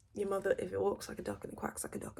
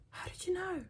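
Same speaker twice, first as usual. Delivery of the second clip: shocked gasp; 11 semitones higher than usual. Retold or imitated. Voicing herself shocked.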